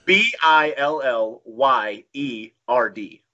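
slow, deliberate spelling. Real, not acted. Wrestler spells an odd ring name for the hosts, slowly and deliberately.

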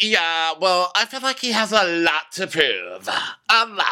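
high mock solemn put-on voice; imitating T J. Podcaster voicing T J judging Mikkel, high mock smugness. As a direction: say it like a smug colleague, way up high. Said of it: "And T J's like".

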